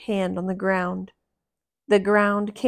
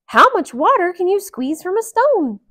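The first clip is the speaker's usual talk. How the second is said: Mickey voice; 10 semitones higher, 10 dB louder than usual. Acted. Mickey speaks.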